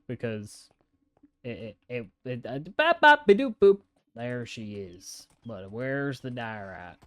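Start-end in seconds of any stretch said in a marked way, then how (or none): 3.0-3.8 high, loud bop-boop sound effects; imitated quick-action noises. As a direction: pop out silly high beeps.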